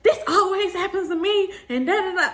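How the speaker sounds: high imitated self-pity; mocking whine. Bridesmaid complaining to the whole room, mocking whine. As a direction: parody her complaint in a high voice.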